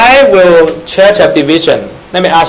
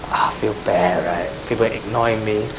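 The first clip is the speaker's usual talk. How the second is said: mock dejection; 8 semitones lower, 12 dB quieter than usual. Imitating a hurt ignored poster.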